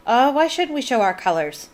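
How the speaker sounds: lightly voiced Irish mother, proud; a touch of a bad Irish accent; a mild Irish brogue for a defiant mum; terrible Irish accent. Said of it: "my terrible Irish accent".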